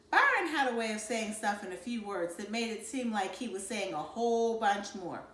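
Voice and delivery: ordinary delivery, nothing marked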